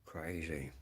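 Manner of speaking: breathy whispered aside to himself; a whispered "Crazy" under the breath. Real, not acted. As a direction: drop to a whisper, shaking your head.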